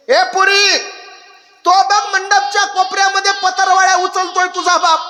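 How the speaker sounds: high, loud, urgent mother; a mother pointing her daughter to her father; mother's voice. Acted. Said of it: "आई काय म्हणते माहितीये". (मुलीला बाप दाखवणारी आई, उंच कळवळीच्या आवाजात)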